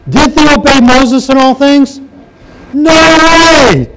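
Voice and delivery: high, loud disbelief; incredulous outburst. Real, not acted. Preacher mocks the people's claim.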